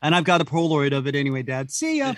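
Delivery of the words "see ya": very high mock farewell; sing-song taunt on the way out; cheeky see ya. Kid taunting dad while fleeing, very high cheeky farewell.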